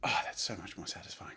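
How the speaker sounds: soft relieved whisper; satisfied whisper. Real, not acted. Drawing a neat line, he whispers with relief. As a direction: breathe it out, pleased.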